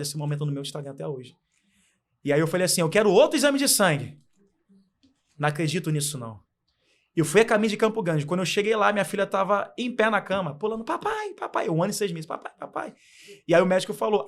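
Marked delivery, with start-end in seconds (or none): 2.8-4.2 loud, raised-pitch retold demand; insistent demand. Father demanding a new blood test, loud insistence. 10.8-11.7 put-on very high little-child voice calling out; imitated toddler. Baby daughter jumping on the bed for dad, high child voice. 12.2-12.9 put-on soft, very high small-child call; imitated toddler. One-year-old daughter calling her dad, soft high child voice.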